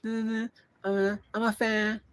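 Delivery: put-on high girly fan voice; hammed-up high girl voice; imitating a girl fan. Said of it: "some girl pops up like".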